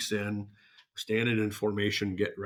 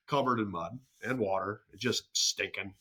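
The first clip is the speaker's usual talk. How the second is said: ordinary talk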